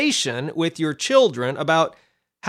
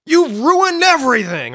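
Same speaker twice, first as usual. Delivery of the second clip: mock angry lash out; 8 semitones higher, 6 dB louder than usual. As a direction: pretend temper tantrum, scolding the sinners. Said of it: "He doesn't lash out at them and say".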